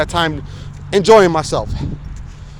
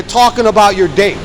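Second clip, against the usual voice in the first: normal talking voice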